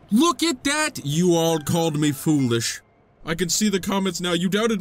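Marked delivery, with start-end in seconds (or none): 0.1-2.8 gloating. Acted. Gloating at doubters.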